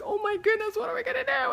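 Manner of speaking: very high, squeaky inner fretting; mock panic. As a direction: panic comically behind a calm front. Retold inner panic over the deal.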